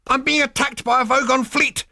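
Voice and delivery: high, loud desperate cry; cried out. A narrator's reading. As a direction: cry out the danger. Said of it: "cried Zaphod".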